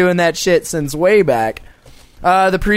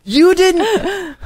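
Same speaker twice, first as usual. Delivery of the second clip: high incredulous "You didn't"; shocked disbelief; 13 semitones higher than usual. Real, not acted. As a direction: gasp it out in disbelief.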